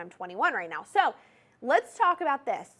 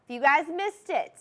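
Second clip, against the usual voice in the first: louder, brighter relaunch of the line; louder, higher restart; 5 semitones higher, 6 dB louder than usual. Shown as a demonstration. Host restarting the line for the viewers, louder and higher. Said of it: "restarts the intro line".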